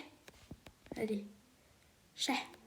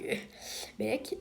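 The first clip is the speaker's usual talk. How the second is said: ordinary talk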